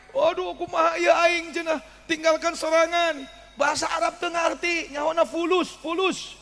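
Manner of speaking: comic granny left behind at the airport; panicked old woman. Acted.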